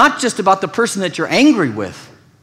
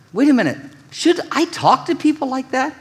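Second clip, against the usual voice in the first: questioning inner voice; 10 semitones higher than usual. Acted.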